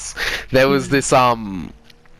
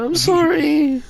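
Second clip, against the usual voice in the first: very high, drawn-out little apology; imitated sheepish kid; much slower than usual. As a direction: squeak it out slowly like a guilty child. Child apologising to angry uncle.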